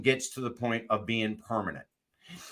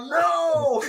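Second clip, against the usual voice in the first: high, loud drawn-out no; horrified shout; 7 dB louder than usual. Retold or imitated. Man spots the executive beside his car, horrified shout. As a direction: cry out in comic dismay. Horrified reaction in retold story.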